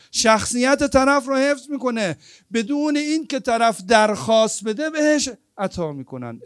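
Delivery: loud, high, impassioned sermon; preaching at full force. Acted. Preacher rousing the mourners, loud and impassioned. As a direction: drive each point home to the crowd.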